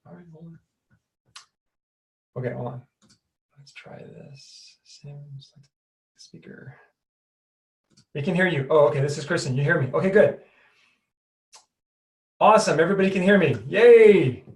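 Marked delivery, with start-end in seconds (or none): 0.1-6.7 very soft, half-whispered mutter; muttering to self. Real, not acted. Muttering to self while fixing audio. 13.7-14.4 loud, drawn-out yay; happy cheer. Real, not acted. Cheers that everybody can hear.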